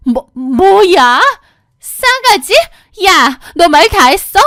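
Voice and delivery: very high, loud stammering fury; outraged sputter. Acted. Sister-in-law outraged at being called rude, shrieking. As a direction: sputter in disbelief.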